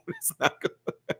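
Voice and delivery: laughing. Real, not acted. Laughing mid sentence.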